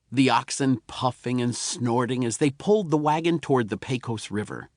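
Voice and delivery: usual speaking voice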